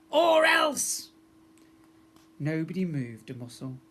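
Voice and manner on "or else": very high, loud yell of Or else; the unseen girl threatening everyone in the hall; shouted threat. Acted. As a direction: bark the threat out of the smoke. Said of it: "a voice shouted".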